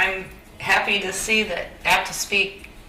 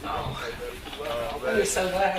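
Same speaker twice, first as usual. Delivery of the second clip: soft teary voice; quiet, still choked up; after crying; 4 dB quieter than usual. Real, not acted. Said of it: "I'm getting teary-eyed".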